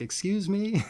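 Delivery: high, startled "excuse me"; a stranger caught off guard, pitch jumping up; baffled stranger. Pretend.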